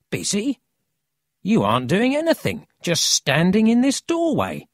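put-on high, incredulous boy's challenge; scoffing disbelief. Boy scoffs at Emil's claim.

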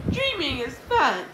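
high, loud call; gleeful yell. A narrator's reading. Miss Anthrope loose in her dream, yelling high. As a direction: sing out the glee, high and loud. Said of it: "she yelled".

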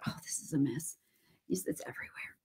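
voiceless mutter to oneself; whispered aside. Real, not acted. Crafter to herself about the mess, whispered aside. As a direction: drop to a whisper, fretting.

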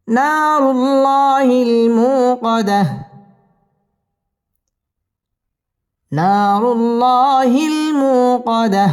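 slow, sung tajweed chant; Quran recitation. Shown as a demonstration. Teacher reciting the surah for students to follow, chanted. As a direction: let each line ring out melodically.

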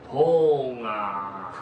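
low pained groan. Acted. Injured monk answers the novice, low groan. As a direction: groan it out from the gut.

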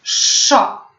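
"Szo" with a long hushing sz before the vowel; drawn-out SZ. Shown as a demonstration. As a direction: model the syllable "Szo", sz held long. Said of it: "Pamiętamy język wysoko".

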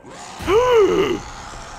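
sharp, high gasp of fright; real frightened gasp; startled gasp. Real, not acted. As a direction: suck in air as if jumped at. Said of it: "Mi hai spaventato tantissimo".

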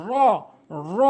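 র as a full, heavy rolled Arabic r; correct full র. Shown as a demonstration. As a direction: curl tongue tip to palate, fill out র. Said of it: "এভাবে বলতে হবে".

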